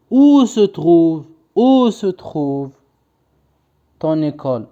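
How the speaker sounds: very slow, drawn-out dictation voice. Shown as a demonstration. Teacher reading a sentence for pupils to copy, slow dictation. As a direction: stretch each word for someone writing it down.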